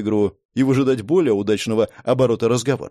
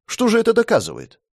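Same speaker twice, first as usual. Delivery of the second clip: puzzled; 10 semitones higher than usual. A narrator's reading. Narrator repeats puzzled question.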